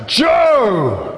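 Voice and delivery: loud, high shout from heaven; booming shout. Retold or imitated. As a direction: bellow the name out like God calling down. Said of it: "he shouts".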